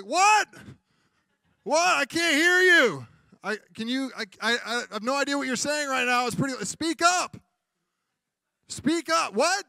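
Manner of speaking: high, loud strained yell; shouting over noise. Retold or imitated. Spouse yelling from the living room over the fan.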